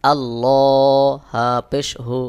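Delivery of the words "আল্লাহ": আল্লাহ with a full, heavy lam, drawn out slowly. Shown as a demonstration. Teacher reciting আল্লাহ with a thick heavy lam. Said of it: "লামকে পড়বা মোটা করে পড়তে হয়".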